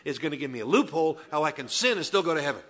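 loud, fast, laughing mock voice; mock loophole seeker. Pastor mocking imagined churchgoers, loud and laughing. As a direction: ham up a sinner hunting loopholes. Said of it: "showed up tonight saying".

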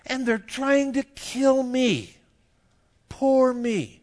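high, slow self-pitying whine; whining Elijah. Retold or imitated. Elijah whining to God about being alone. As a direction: whine like a sulking prophet. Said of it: "probably with a little bit of whining in his voice".

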